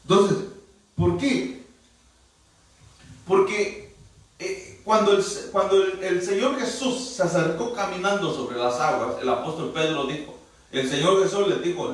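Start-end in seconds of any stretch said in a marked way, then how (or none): none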